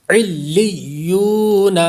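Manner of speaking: علیون recited slowly, ain joined to the doubled lam and ya, no ghunna; tajweed recitation. Shown as a demonstration. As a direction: join lam and ya in علیون without nasalizing. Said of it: "اس طرح سے غنہ نہیں کرنا".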